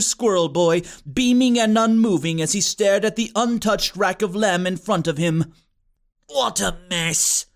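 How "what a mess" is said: grumbling. A narrator's reading. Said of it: "Joshua grumbled".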